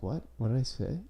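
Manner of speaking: softly imitated baffled stoner; stoned, confused kid. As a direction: ask it quietly, as a lost stoned kid. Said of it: "the kid was just so stoned".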